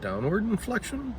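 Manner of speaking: "downward inflection" lifted high at the end as a question; upward inflection. Shown as a demonstration. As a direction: turn "downward inflection" into a question with a steep rise. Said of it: "an upward inflection is".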